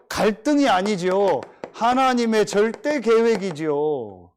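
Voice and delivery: loud, slow, emphatic sermon delivery; full-throated preacher's proclamation; preaching at full force. Acted. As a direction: hammer each phrase to the congregation.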